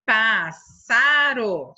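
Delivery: PÁSSARO stretched out in slow, loud syllables; very slow, emphatic, divided "pássaro". Shown as a demonstration. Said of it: "Um S vai com o pá".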